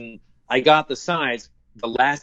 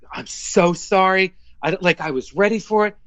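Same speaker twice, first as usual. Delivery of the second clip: retold distressed apology; 7 semitones higher than usual. Retold apology to agent after failure.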